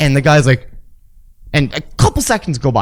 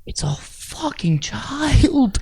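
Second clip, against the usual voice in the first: calm inner thought; 7 semitones higher, 4 dB quieter, much slower than usual. Retold or imitated.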